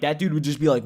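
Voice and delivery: normal talking voice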